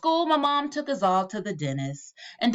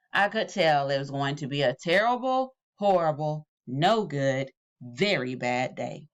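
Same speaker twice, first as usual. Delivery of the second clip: each phrase sinking lower and glummer; dejected refrain dropping lower phrase by phrase; gloomy refrain; 6 semitones lower than usual. A narrator's reading. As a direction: sigh out the refrain, dragging down.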